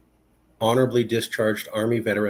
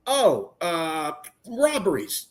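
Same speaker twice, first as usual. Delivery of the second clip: higher, stammering cop voice; hesitant cop; 5 semitones higher, much slower than usual. Retold or imitated. Officer inventing a reason, higher hesitant stammer. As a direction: fumble for an excuse.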